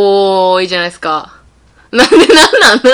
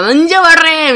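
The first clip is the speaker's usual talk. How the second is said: higher-pitched imitation of a snapping girl; mock angry outburst; 6 semitones higher, 4 dB louder than usual. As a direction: play-act a cute character flying into a rage. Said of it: "めっちゃキレる子". (キレた女の子の真似で「なんじゃわれー」)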